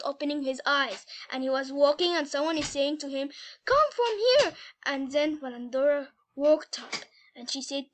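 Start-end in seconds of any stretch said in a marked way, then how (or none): 3.6-4.5 high, loud summons; calling out. A narrator's reading. Mysterious voice to sleepwalking Peter, calling out. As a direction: call him over, voice raised.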